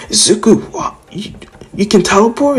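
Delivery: high, astonished question; can't believe he can teleport, pitched high; amazed. Retold or imitated. Friends amazed that Izuku can teleport, high disbelief.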